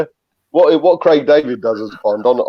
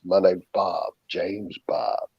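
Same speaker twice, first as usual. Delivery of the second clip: lightly put-on American accent, low and quiet; a mock American Bond intro, muttered low; half-hearted American accent on the Bond catchphrase; 4 semitones lower, 9 dB quieter, slower than usual. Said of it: "I said it in an American accent".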